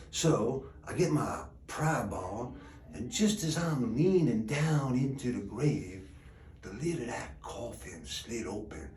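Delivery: low, soft storytelling, dropping almost to nothing; quiet, ominous build-up; hushed suspense. A narrator's reading. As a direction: draw them in softly before the scare. Suspense as the coffin opens.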